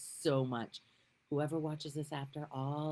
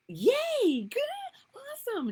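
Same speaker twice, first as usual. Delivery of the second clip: mock cheer; 9 dB louder than usual. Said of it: "I was like".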